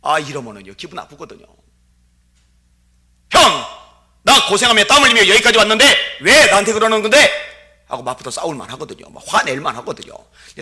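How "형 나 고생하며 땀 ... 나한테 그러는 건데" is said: loud, high hurt outburst; indignant protest. Retold or imitated. David shouting back at his older brother, loud and indignant. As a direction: fire back at the big brother.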